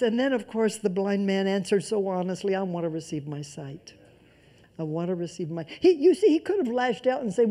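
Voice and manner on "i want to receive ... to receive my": quiet plea. Acted. Preacher voicing blind man's plea.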